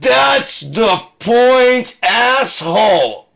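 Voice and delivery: drawn-out, high-pitched furious shout; huge slow acted yell. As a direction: bellow each word very slowly at them. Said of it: "I'm yelling at you. It's really loud".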